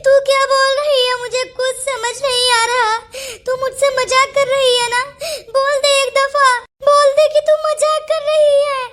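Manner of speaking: high, crying plea; tearful disbelief. Acted. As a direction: beg desperately through sobs. Begging friend to be joking.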